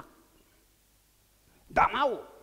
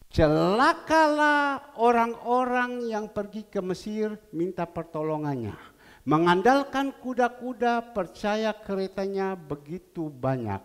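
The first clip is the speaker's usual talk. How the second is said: loud scripture reading; 9 dB louder than usual. Acted. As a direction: thunder out the verse of woe. Reads scripture loudly.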